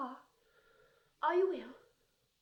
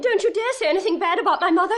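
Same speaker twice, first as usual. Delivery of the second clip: fast, louder, indignant outburst; fierce defence; 5 semitones higher, 8 dB louder, faster than usual. Acted. Daughter defends her mother against her father, fast and fierce. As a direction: snap back to protect her mother.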